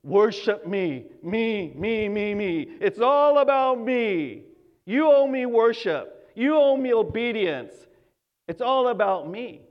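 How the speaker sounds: hammed-up vain ruler insisting on worship; mock egotistical deity on his throne; self-centered god. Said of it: "sitting on his throne and saying".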